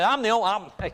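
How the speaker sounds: high, eager stammered pledge; Peter boasting. Acted. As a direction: start an over-confident boast and stumble. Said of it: "That's what Peter said".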